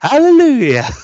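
high, loud revival cheer; mock rejoicing. Imitating dancing, clapping churchgoers, mock rejoicing. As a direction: whoop it out like a jubilant congregation. Acts out jigging, clapping worshippers.

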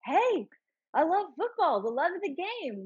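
light impression of a puppy-like enthusiast; mock Danny Rojas cheer. Cohost imitates the overjoyed soccer player, high and bouncy.

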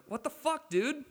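high-pitched outraged outburst, words spilling out; angry blurt. Retold or imitated. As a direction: let it burst out in disbelief at the bartender. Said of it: "blurt out".

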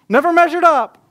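Never measured up shouted loud and high; yelling out. Retold or imitated. Imagined person yelling their shame, Never measured up shouted high. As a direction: bellow out Never measured up as a public confession. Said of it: "you had to yell out your greatest pain".

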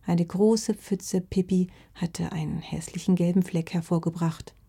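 ordinary delivery

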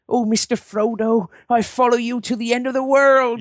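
high, hammed-up West Country accent; devoted hobbit with a rustic West Country accent; West Country farmer accent. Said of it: "up north farmer type dudes".

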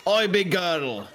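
high, called-out holler; acted shout, pitched well up. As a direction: holler to rouse a sleeper. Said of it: "I'm gonna shout".

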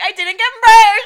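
slow, high, loud fake cry; mock wail. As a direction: whimper it out like a kid who lost. Mock wailing about merch.

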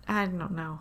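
normal talking voice

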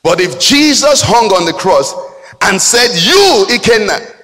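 high, forceful proclamation; emphatic preaching. Real, not acted. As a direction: hammer it home from the pulpit. Preacher drives home the cross.

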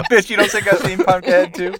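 high, laughing mimic of a friend; mocking imitation. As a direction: parrot him back, laughing. Mimicking Josh's line.

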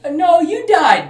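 high, loud, drawn-out contradiction; exaggerated singsong correction; mock correction. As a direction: stretch it out high, as if correcting someone slow. Voices blunt reply in retold film.

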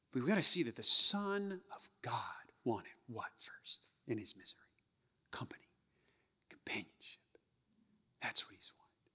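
soft hushed whisper; whispered to the congregation. Real, not acted. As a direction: lean in and whisper it. Intimate reflective sermon moment.

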